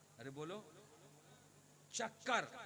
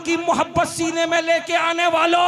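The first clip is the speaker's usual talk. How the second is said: high, loud preaching call; full-force preaching; 6 semitones higher, 16 dB louder than usual. Acted. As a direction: rouse the whole crowd, loud and high. Preacher addresses the crowd.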